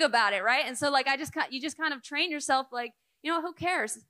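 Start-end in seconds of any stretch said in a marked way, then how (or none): none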